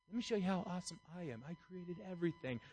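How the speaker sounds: hushed, fast voice from above; quick and hushed, like a godly aside; God speaking. Pretend. Preacher voicing God to the boastful, hushed and quick.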